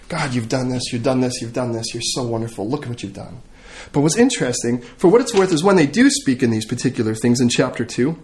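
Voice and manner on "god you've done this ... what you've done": low, soft prayerful repetition; hushed praise. Acted. Disciples praise God's wonderful works, low and hushed. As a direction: murmur thanks as if praying privately.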